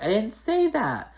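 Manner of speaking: high, slow mock protest; mock indignant denial. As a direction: draw out a high-pitched, imitated denial. Acted denial in retold argument.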